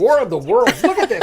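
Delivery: high, loud laughing. Real, not acted. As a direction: burst out laughing at the sight. Laughs at Orson Welles look.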